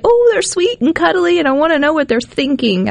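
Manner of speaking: high-pitched adoring coo; lightly acted, high cutesy gushing; gushing fondness. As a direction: gush over a cute little animal. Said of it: "you're like".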